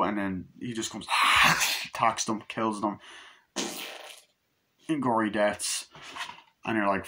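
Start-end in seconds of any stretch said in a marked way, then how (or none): 1.1-1.8 voiceless mock roar; sudden breathy attack noise; imitated werewolf snarl. Reviewer acting out the werewolf pouncing, snarl.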